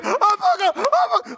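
very high-pitched blubbering babble; hammed-up group commotion. Whole team jumping up to hug, high mock-emotional babble. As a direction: squeal it like an overcome mob. Imitates the team piling into hugs.

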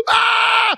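high, loud yelp of pain; pained cry. Retold or imitated. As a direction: cry out as the arm is pulled. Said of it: "He goes".

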